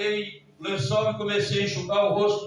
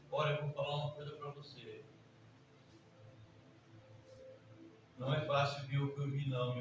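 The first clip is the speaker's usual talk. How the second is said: hushed, low, quick imitation; retold tearful confession; 8 semitones lower, 12 dB quieter, faster than usual. Preacher retelling a crying man's confession, subdued. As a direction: murmur it low like a shaken man. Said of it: "Ele chorando".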